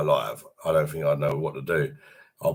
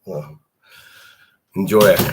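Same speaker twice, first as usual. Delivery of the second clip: ordinary delivery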